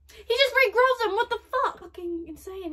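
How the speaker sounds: loud, high-pitched real disbelief; shocked outburst. Real, not acted. Viewer watches the demon regrow his arm, shocked outburst. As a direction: exclaim it at full volume, stunned.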